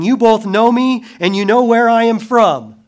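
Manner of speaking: high, loud proclamation in the temple; crying out. Acted. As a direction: call it out boldly to the crowd.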